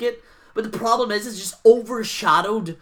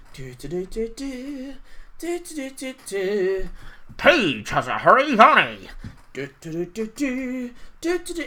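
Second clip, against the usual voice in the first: hummed music. Retold or imitated. Imitating background music again.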